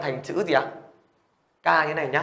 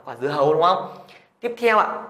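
ordinary talk, nothing marked

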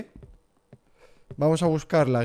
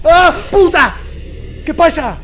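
very high, very loud panicked cry; terrified scream; 12 dB louder, much slower than usual. Real, not acted. Streamer scared by the girl, screaming for a doll. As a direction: scream in sheer fright.

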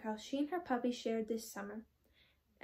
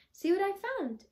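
high, louder burst of delight; excited exclaiming; 8 semitones higher, 4 dB louder than usual. Acted. Puppy showing his mother the boot, high and excited. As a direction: show off the treasure eagerly. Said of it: "exclaimed Pokey".

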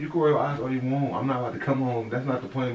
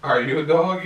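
louder, higher throw-back of her words; mocking comeback; 5 semitones higher, 10 dB louder, slower than usual. Real, not acted. Boyfriend turning her dog question back on her, loud and mocking. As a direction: fire it right back at her.

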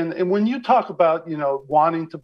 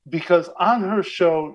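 ordinary talk, nothing marked

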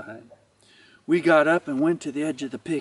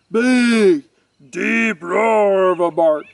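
loud, drawn-out booming words; booming narration; 6 dB louder, slower than usual. A narrator's reading. Narrator describing the boxer's huge bark, booming. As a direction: boom it out like a big dog's bark.

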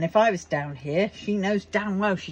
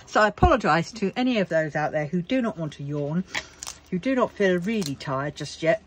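ordinary talk, nothing marked

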